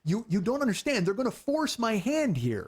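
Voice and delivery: high-pitched, insistent retold plea; exasperated pleading. Telling girlfriend his hand will be forced, exasperated pleading. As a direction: plead your case, pitch climbing.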